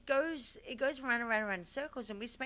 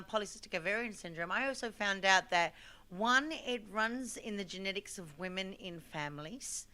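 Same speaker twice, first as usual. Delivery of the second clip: ordinary talk, nothing marked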